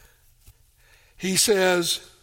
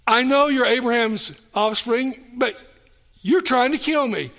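higher and louder, a blunt accusing quote; blunt accusation; 5 semitones higher, 3 dB louder than usual. Shown as a demonstration. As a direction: throw the charge right at them. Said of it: "Jesus is, is very blunt".

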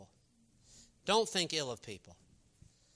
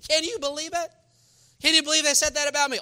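mock indignant gossip; 11 semitones higher, 9 dB louder, faster than usual. Gossip complaining acted out.

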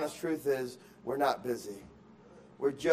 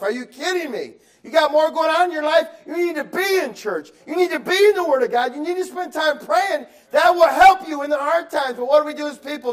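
high and loud, full-force preaching; fiery preaching; 12 semitones higher, 9 dB louder than usual. Acted. Pastor exhorting the church to pray and read the Word, full-force preaching. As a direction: drive it home to the congregation.

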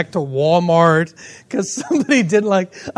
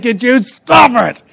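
playful mock exasperation; exasperated plea; 3 semitones higher than usual. Owner snapping at his endlessly licking dog, mock exasperated. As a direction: act fed up with the noise.

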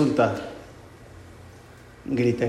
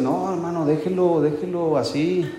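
higher, lightly mocking indulgent voice; mock permissive parent; 4 semitones higher than usual. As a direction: hand the blame back in a sly sing-song. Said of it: "le voy a decir". (imitando con burla, voz más aguda y lenta)

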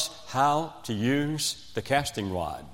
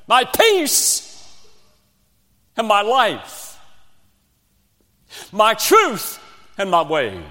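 rising, louder litany of names for Christ; preaching at full force; 5 semitones higher, 5 dB louder than usual. Acted. Preacher to congregation, a fervent rising litany. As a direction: build the list like a fervent preacher.

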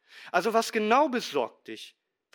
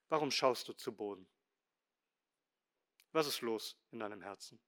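sanft fragend; 7 semitones lower, 11 dB quieter, faster than usual. Acted. Gott fragt sein Kind sanft.

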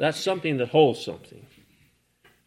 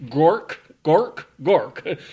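mock taunt; 5 semitones higher, 9 dB louder, much slower than usual. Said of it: "The enemies kept saying".

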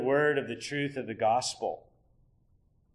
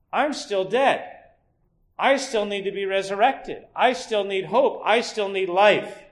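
louder and higher, pleading and building; desperate sinner's cry; 5 semitones higher, 5 dB louder than usual. Retold or imitated. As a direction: cry out as a lost sinner longing for life. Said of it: "asks the question".

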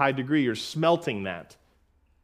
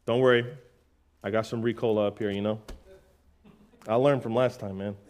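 usual speaking voice

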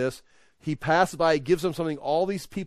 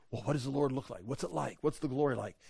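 much softer, curious aside; hushed wondering; 2 semitones lower, 10 dB quieter, faster than usual. Acted. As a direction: drop right down and wonder under your voice. Hushed wondering before the gentle whisper.

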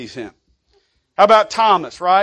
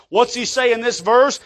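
ordinary talk

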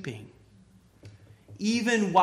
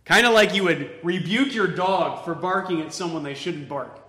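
ordinary delivery

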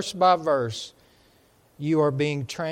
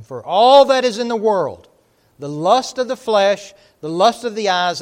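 loud, ringing scripture read from the pulpit; preaching at full force; 9 dB louder than usual. Acted. Preacher reading First John to church, booming. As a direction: thunder the verse out to the congregation.

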